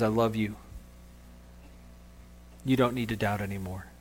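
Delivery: soft, low reassurance; gently reassure, hushed and low; tender. Acted. Jesus reassuring doubting Peter after pulling him out, tenderly. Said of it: "That tender moment between Jesus and Peter".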